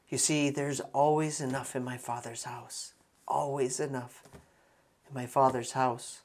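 soft, intimate comfort; steady someone quietly, barely raising your voice; hushed reassurance. Acted. Jesus to grieving father after bad news, hushed reassurance.

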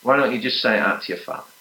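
ordinary talk

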